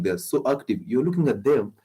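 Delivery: ordinary talk, nothing marked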